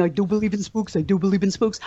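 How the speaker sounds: lightly voiced timid lion from Oz; a hint of the scared Cowardly Lion chanting; mild mock-fearful lion; Cowardly Lion impression. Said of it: "do you remember the Cowardly Lion".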